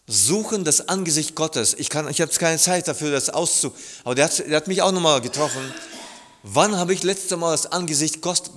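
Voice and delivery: ordinary talk, nothing marked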